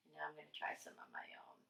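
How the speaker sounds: quick, low whisper to herself; fast whisper. Real, not acted. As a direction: rush it under your breath. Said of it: "whispered narration".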